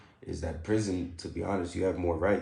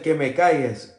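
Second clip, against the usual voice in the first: retold order; 4 semitones higher, 8 dB louder than usual. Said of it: "she said".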